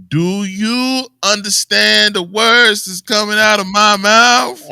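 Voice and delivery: very slow, loud and high-pitched, word by word; mock talking down to a foreigner, drawn out and loud; exaggerated slow talk. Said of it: "and I talk slower".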